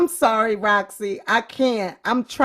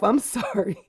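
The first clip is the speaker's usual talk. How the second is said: ordinary delivery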